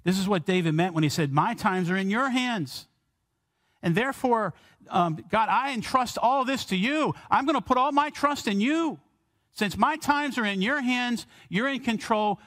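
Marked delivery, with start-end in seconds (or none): 1.3-12.4 higher, lifted pleading prayer to God; earnest prayer. Acted. David praying to God, handing over his times, lifted and earnest. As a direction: pray it up high as David entrusting all.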